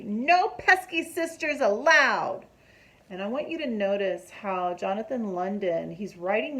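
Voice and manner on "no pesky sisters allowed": loud, slow bossy shout; a big brother ordering his sister off; yelling down. A narrator's reading. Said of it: "Froggy is yelling down at his sister".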